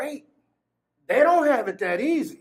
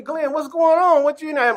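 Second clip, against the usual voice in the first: calling out; 7 semitones higher, 5 dB louder than usual. Retold or imitated. Guard calls over music.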